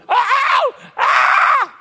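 loud, very high shrieks; imitated scream. Preacher retelling screams heard in the motel, loud high imitated shrieks. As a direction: mimic a game-show winner screaming.

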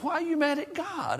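very high-pitched, held-back disbelief; incredulous question. Retold or imitated. Preacher challenges the boy's confession.

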